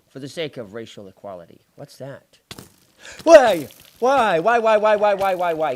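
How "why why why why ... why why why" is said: loud, high repeated cries; panicked shouting. Real, not acted. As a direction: yell in alarm as enemies attack. Panicked shouting during attack.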